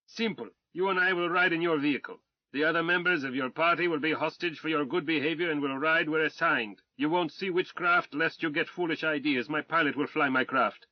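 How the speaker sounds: a blustering Tsarist officer shouting insults; arrogant Russian colonel. Acted. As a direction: rage at the British swine with haughty contempt. Colonel dictates terms.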